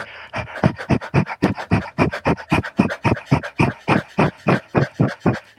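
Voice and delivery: quick unvoiced dog-like panting. Shown as a demonstration. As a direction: pant hard with tongue out like a dog. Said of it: "start panting like a dog".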